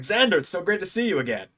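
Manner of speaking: high-pitched wrestler impression; Joe Hendry. Wrestler greets returning fan, impersonated. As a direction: imitate an enthusiastic star's greeting. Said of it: "not the best Joe Hendry impression".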